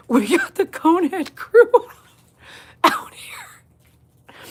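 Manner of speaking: whispered through giggles, slow; can't hold back the laugh. Real, not acted. Laughing at dog in cone.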